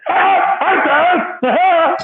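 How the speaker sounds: very high, goofy nasal-free squeak; hammed-up high cartoon greeting; Pee Wee Herman voice. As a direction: chirp it like a giddy man-child. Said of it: "the Pee Wee Herman voice guy".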